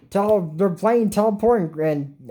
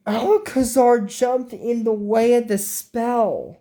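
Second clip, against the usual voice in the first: higher, slowed dramatic telling; excited retelling; 6 semitones higher, slower than usual. Retold or imitated. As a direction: lean into the big moment slowly.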